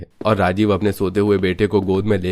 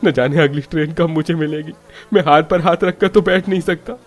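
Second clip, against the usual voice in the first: worried, higher-pitched insistence; restless, distressed son's outburst; anxious urgency; 7 semitones higher than usual. Acted. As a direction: fret that you must reach your father. Anxious son misses last train.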